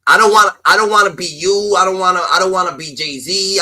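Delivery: usual speaking voice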